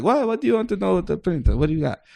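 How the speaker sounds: a lightly voiced Israeli hustler; Israeli friend impression. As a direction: play the wheeling-dealing Israeli friend. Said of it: "the way the Israelis were".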